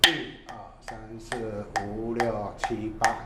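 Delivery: slow, soft, even counting; drawn-out, quiet tempo count. Shown as a demonstration. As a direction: count the beats in slowly and low. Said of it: "慢的速度".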